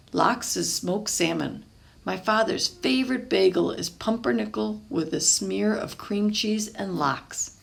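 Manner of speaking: usual speaking voice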